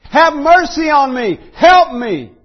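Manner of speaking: high, loud, desperate cry for mercy; shouting plea. Retold or imitated. The woman crying after Jesus, shouting for mercy. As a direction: shout it as a begging mother. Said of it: "she keeps shouting".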